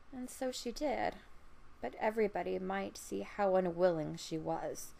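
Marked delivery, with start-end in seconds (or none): none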